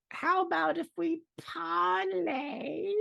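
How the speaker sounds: very high, slow squeaky goblin; shrill little goblin asking sweetly; female goblin voice. Acted. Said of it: "you hear a female goblin voice go".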